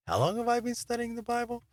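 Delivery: high-pitched, wondering aside; mock-amazed, pitched well up; incredulous self-questioning. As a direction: ask it in a high, disbelieving little voice.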